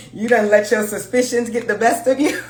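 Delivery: talking through real laughter; amused, laughing at the cards. Real, not acted. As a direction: laugh it out while scolding. Laughing at Leo's Tower card.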